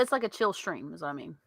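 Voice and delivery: stressed "stream" in "chill stream". Real, not acted. Correcting co-host that it's a chill stream, stress on "stream". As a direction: lean on "stream" to set it apart. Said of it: "glad you emphasised that".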